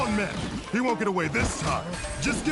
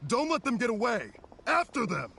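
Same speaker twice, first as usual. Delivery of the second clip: pursuit order; 6 semitones higher than usual. Acted.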